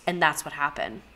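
ordinary delivery

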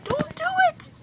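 squealed, hammed-up begging at the screen; frantic mock plea. Viewer begging Bianca not to do it, frantic mock plea.

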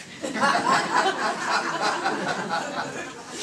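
real laugh at his own joke; breathy laugh. Real, not acted.

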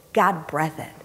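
ordinary talk

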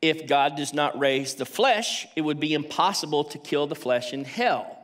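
ordinary talk, nothing marked

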